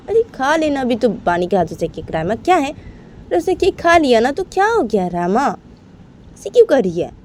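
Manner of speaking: high, exasperated scolding; scolding sister. Acted. Rama's sister scolding her for sulking, exasperated and high.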